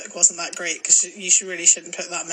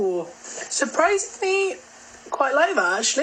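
surprised; 6 semitones higher than usual. Real, not acted. Tasting the sweet.

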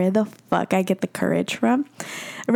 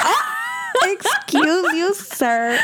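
shrieked high "Ah", then a slow drawn-out "excuse you, sir"; mock outrage; 10 semitones higher, much slower than usual. Retelling his kiss request to friends, in mock outrage. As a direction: play scandalised, a hammed-up shocked lady.

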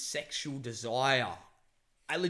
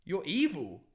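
high, accusing outburst; snapping angrily; 5 semitones higher, 4 dB louder than usual. Retold or imitated. Man telling the manipulative woman to leave, angry. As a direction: retell a heated accusation ending in an order. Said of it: "I just snapped out of it".

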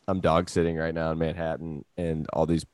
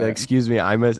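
mock complaint; 4 semitones higher, 7 dB louder than usual. Mock complaint to Chinese officials.

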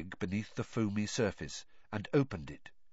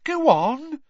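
high, loud bossy push; 8 dB louder than usual. Acted. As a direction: boss him around gleefully. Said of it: "enjoying bossing him around".

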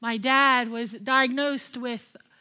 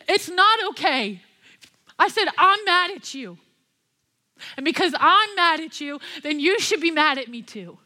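angry retort; 2 semitones higher, 4 dB louder than usual. Retold or imitated. A grieving daughter shouting at God over her sick dad. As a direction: throw the anger back at God. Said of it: "made me even more mad".

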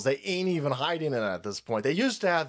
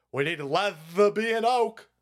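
slow, higher, lisping put-on voice; mock fussy official; 5 semitones higher, slower than usual. Officials itemizing Capitol window repairs, mock fussy lisping demand. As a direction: stretch out the silly request with a lisp.

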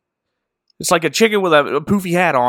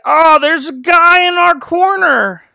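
put-on scared girl voice; 13 semitones higher, 5 dB louder than usual. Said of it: "Christine's all like".

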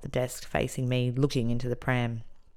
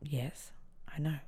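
small, soft acknowledgement; subdued reply; 13 dB quieter, slower than usual. Retold or imitated. Inmate answering the warning supervisor, subdued. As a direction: answer softly, giving nothing away.

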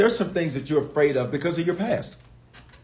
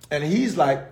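ordinary delivery

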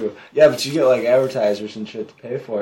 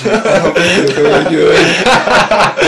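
laughing; 9 semitones higher, 10 dB louder than usual. Acted. Joking, laughing loud.

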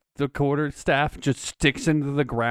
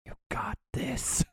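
telepathic whisper; 11 semitones higher, 9 dB quieter than usual. Acted. Player telepathically encourages Eliana, in a soft whisper. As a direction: whisper it, very softly. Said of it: "with my telepathy".

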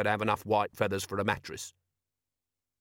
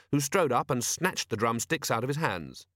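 ordinary delivery, nothing marked

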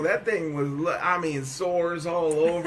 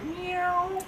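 high, soft little meow; cat meow; 11 semitones higher, 4 dB quieter than usual. Retold or imitated. Husband imitating the healed friendly cat, high soft meow. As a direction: purr out a sweet kitten meow.

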